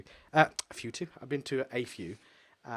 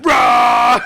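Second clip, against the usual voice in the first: loud cry thrown out high; mock anguished roar; 8 semitones higher, 19 dB louder than usual. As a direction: bellow it like a wounded beast. Cry at the memory of the bowels.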